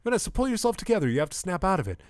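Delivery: high, fast urgent order; urgent scolding. Acted. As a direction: scold a panicking partner in a rush. Acted panicked quarrel in cockpit.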